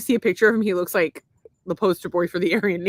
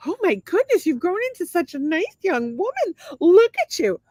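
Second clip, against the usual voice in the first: high-pitched, hammed-up cooing over a child; mock a fawning grown-up, pitched way up; gushing adults; 9 semitones higher than usual. Adults greeting the awkward tomboy she was, mock gushing. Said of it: "would be like".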